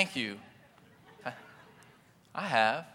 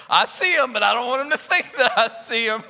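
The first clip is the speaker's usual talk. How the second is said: higher, louder, playful pretending voice; mock sneaky driver; 8 semitones higher, 6 dB louder than usual. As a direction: act like you're hiding that you saw them. Driver pretending not to see.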